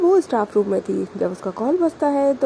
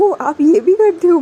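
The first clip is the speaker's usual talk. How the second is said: surprised; 7 semitones higher, 8 dB louder than usual. Acted. Friend shocked couple fights.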